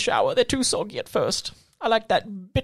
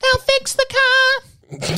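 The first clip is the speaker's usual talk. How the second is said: high, slow, drawn-out character voice; squeaky slow put-on voice; silly high voice; 3 dB louder, much slower than usual.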